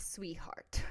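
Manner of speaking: imitating his hushed condescending whisper; whispered sweetheart as retold; mock whisper. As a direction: breathe the word like talking down. Husband quoted condescendingly.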